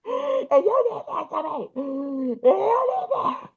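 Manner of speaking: slow, high re-enacted wail, running out of air; mock breathless crying holler, dragged out; weakening holler. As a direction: act out screaming while growing weaker. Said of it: "getting weaker and weaker, but I'm still screaming and hollering".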